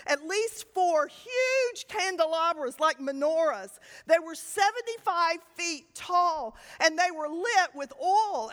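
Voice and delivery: normal talking voice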